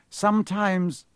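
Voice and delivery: anxious servant's voice; fearful. A narrator's reading. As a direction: start with a spasm of fear. Quirrell gripped by fear of master.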